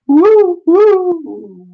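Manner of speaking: very high, loud "woo"; mock-alarm high whoops; playful whooping. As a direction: hoot like someone swept off their feet. Figure blown around, playful noise.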